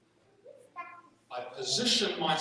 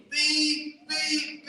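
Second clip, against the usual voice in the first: high imitated horn beeps; 11 semitones higher, 4 dB louder, much slower than usual. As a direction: beep like a car horn.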